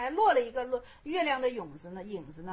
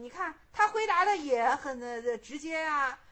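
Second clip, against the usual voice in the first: ordinary delivery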